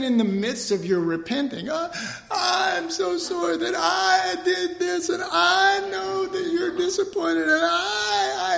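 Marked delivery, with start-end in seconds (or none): 1.7-8.6 high, slow hammed-up sobbing plea; mock wailing repentance. Repenting sinner to the Lord, high slow mock sob. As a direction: wail the apology like a fake sob.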